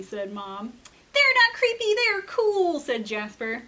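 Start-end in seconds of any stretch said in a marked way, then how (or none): 1.1-2.8 put-on high, loud little-kid protest; indignant kid. Jasper arguing with Mom, high indignant kid voice. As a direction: insist like an offended small boy.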